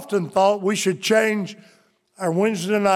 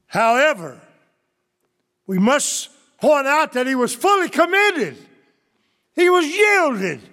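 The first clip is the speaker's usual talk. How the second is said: full-force preaching; 2 semitones higher than usual. Acted.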